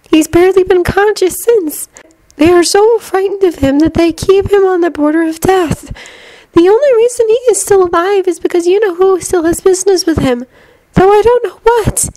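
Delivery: put-on high-pitched girl's character voice; Luna, explaining. A narrator's reading.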